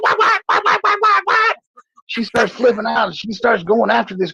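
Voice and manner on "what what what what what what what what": very high, rapid shocked mother; mother shrieking at the pregnancy news. Retold or imitated. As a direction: shriek it like a stunned mom. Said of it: "she's like".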